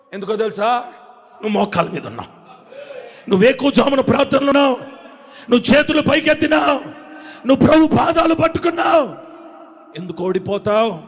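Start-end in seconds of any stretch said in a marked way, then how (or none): 3.3-9.1 from lively to loud and high; building preaching. Acted. Preacher reminding listeners of prayer, building to a shout. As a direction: climb with each line.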